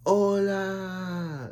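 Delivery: high, drawn-out sing-song hola; exaggerated hola. Renzu greeting Belle out of nowhere, high drawn-out hola. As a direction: throw out "Hola!" high and stretched, playing it up. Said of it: "when Renzu's like".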